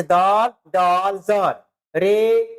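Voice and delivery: रे, डे, जे stretched out slowly; slow letter drill. Shown as a demonstration. Teacher recapping the letters slowly for the class. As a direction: linger on each letter. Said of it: "आवाज एक जैसे आती है".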